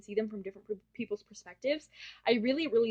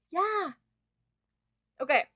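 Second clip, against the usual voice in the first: usual speaking voice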